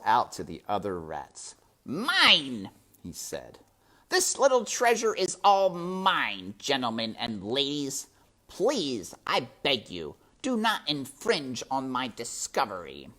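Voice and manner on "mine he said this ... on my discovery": higher, louder grand claim; possessive rat. A narrator's reading. Botticelli claims his prize before the rats, higher and louder. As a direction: stake the claim to the crowd.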